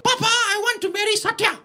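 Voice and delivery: put-on very high, pleading girl's voice; hammed-up high wail; melodramatic daughter. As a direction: beg papa like a drama heroine. Girl pleading in Tamil drama.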